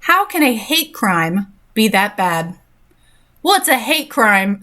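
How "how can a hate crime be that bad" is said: drawn out slowly, letting each word land; slow it down so they ponder it; slow rhetorical reread. Shown as a demonstration. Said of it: "just take a second to ruminate on that".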